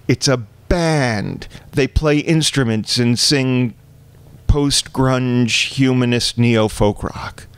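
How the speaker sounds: slow, deliberate, spelled out; slow patient explaining. Retold or imitated. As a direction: explain it slowly to mom. Explaining band to mother slowly.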